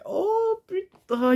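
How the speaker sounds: « oh putain » imité très aigu et étiré, lentement; imitation de la réaction. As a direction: imiter quelqu'un choqué, voix perchée qui traîne. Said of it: "il a fait". (le commentateur cite un spectateur, « oh putain » aigu et traînant)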